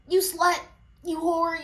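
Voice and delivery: imitating mom's insults. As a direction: spit it out like the mother did. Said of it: "she would just be like".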